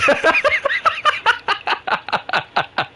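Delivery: very high squealing laugh; hard laughing. Real, not acted. Cracking up at friendship bond joke, squealing with laughter. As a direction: laugh helplessly, high and long.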